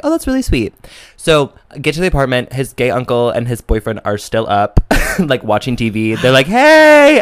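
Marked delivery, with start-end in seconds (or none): 0.0-0.7 high sweet voice. Retold or imitated. Mock reaction to uncle's partner. 6.5-7.2 squealed high hello; high loud greeting. Retold or imitated. Imitating uncle's greeting.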